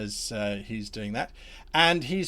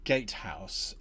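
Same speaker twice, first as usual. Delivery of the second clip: usual speaking voice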